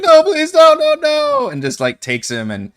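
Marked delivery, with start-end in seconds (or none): 0.0-1.5 desperate begging. Acted. Wumpus taken by the dog.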